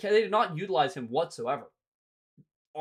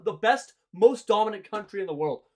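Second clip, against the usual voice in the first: usual speaking voice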